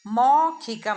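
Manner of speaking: मौखिकम said clearly as maukhikam, stressed; मौखिकम with the long au; correct pronunciation. Shown as a demonstration. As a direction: spell out मौखिकम with a full au sound. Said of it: "उच्चारण होता है मौखिकम".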